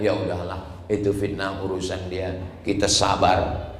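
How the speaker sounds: low, softened giving-in voice; mock resignation. Preacher mimics people who let slander pass, mildly resigned. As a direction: imitate someone letting it go.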